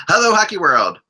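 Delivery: show host opener. Real, not acted. Opens the show.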